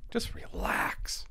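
slow breathy whisper to calm himself down. Real, not acted. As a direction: whisper it slowly, soothing yourself. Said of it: "can't I just relax?".

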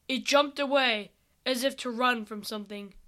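ordinary delivery, nothing marked

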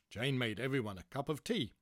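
ordinary delivery, nothing marked

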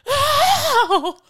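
very high, loud mock "ow"; hammed-up shriek of pain; freaking-out yelp. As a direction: screech it like an overdramatic hurt girl. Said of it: "Starts freaking out".

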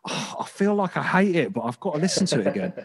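high-pitched, torn complaint; mock reluctant groan. Listener torn over a record they keep replaying, mock reluctant complaint. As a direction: act out grudging compulsion, pitch jumping up.

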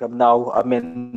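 ordinary talk, nothing marked

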